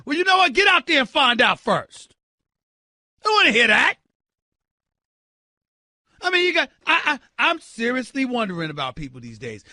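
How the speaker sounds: ordinary talk, nothing marked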